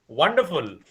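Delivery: वंडरफुल with a clear W sound, English style; teacher models वंडरफुल with an English W; correct English wonderful. Shown as a demonstration. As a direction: say वंडरफुल as "wonderful", lips rounded on the W. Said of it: "वंडरफुल नहीं".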